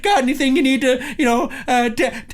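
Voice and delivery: high, loud mock Canadian accent; hammed-up chatty Canadian guard, "eh" after each question. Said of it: "you come to the Canadian border guard".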